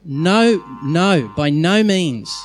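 stamp each "no" down hard; emphatic no. Shown as a demonstration. Pastor hammering Paul's point home, with heavy stress on "no". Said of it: "He's emphatically making a point".

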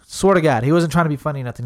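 ordinary talk, nothing marked

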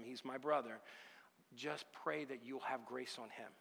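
softened, retold plea to God; heartfelt prayer. Believer prays for the man suing him, hushed earnest prayer. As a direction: pray quietly for the one attacking you. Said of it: "but I prayed a blessing".

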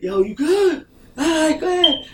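high-pitched retold call to another driver. Checking on the driver he honked at, high-pitched call.

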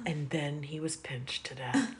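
soft, slow mock trailer announcer; hushed, dramatic trailer tease; movie trailer voice. Pitching a Pincher movie trailer.

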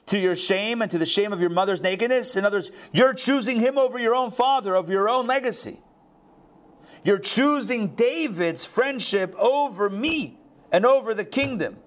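high-pitched voicing of an outraged father; indignant accusation. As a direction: press the betrayal home, pitched well up. Voicing Saul's rage at son.

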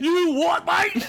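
mock cockney "you what, mate"; British accent. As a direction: put on a thick London accent. Mocking British slang.

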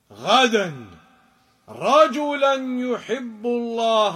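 measured, chanted hadith recitation; Arabic recitation. Acted. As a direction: recite the Prophet's words with reverence.

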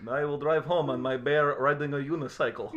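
mock Russian accent. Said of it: "You know, that stereotype".

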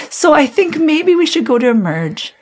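high, singsong suggestion; half-laughing suggestion. Retold or imitated. Wife asking husband for a night-time ER trip, high half-laughing suggestion. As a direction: float it up high as a sheepish proposal.

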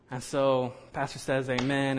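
usual speaking voice

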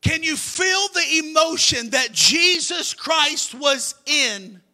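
fervent preaching. Acted. Preacher at full force.

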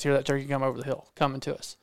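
ordinary delivery

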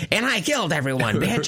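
shrill mocking villain line; killer mom voice. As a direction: snap it out like a slasher mom. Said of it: "she's like".

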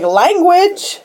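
high-pitched reproving cry; mock scolding. Pansy objecting to the angel's language, high mock scolding. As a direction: pipe up as if scandalized.

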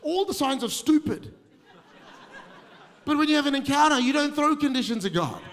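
loud, high preaching build; fervent sermon delivery. Acted. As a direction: drive it home from the pulpit. Preaching at full force.

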